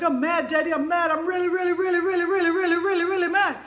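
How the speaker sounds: high, loud mock tantrum shout; angry child shouting. Niece yelling at her daddy, imitated tantrum shout. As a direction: belt it like a furious little kid. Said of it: "she was like".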